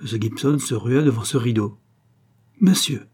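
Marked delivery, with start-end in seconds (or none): 2.6-3.1 loud, high cry of outrage; outraged. A narrator's reading. Outraged husband confronts the man guarding the curtain, outraged. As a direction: call out sharply, pitched well up. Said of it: "dit alors l'époux outragé".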